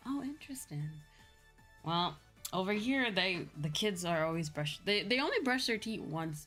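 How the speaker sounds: ordinary talk, nothing marked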